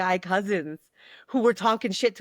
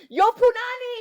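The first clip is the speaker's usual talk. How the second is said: high, loud street shout of Yo, Punani; calling out; 7 dB louder than usual. Retold or imitated. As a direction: yell across the street at a stranger you recognize.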